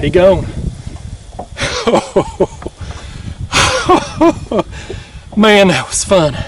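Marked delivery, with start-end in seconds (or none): 0.5-5.3 long breathy laugh after the catch; breathless laugh. Real, not acted. Fisherman laughing alone after releasing a big catfish, breathless laugh. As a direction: laugh it out, spent and delighted.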